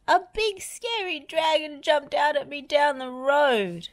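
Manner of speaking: high, breathless scared report; frightened Lofty. A narrator's reading. Lofty tells Wendy and Bob about the dragon, scared. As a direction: blurt out the scary news.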